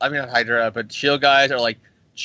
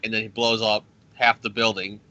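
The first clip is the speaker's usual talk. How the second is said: usual speaking voice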